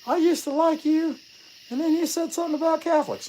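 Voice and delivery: high mock-offended viewer voice; squeaky put-on viewer voice; imagined viewer complaint. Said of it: "I can hear it now".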